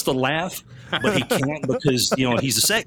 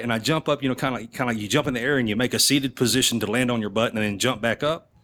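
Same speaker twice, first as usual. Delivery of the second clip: normal talking voice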